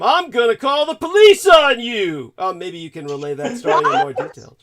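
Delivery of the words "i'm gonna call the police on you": higher, louder imitated threat; retelling the gym old man, loud mock threat. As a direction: shout it like an outraged old man. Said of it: "this old man at the gym told him".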